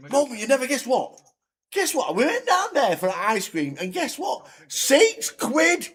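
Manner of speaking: put-on high, eager child's voice; excited little girl. Little girl telling her mum about the ice cream, high and eager. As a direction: squeal it like a kid bursting with news.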